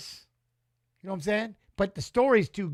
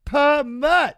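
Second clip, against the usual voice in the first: mock accusing shout; 5 semitones higher, 9 dB louder than usual. Real, not acted. Teasing chat, repeats the word.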